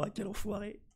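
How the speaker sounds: high mock indignant cry; mock viewer outrage. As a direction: squeak it out like a fooled viewer. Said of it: "ils regarderont la vidéo et me diront".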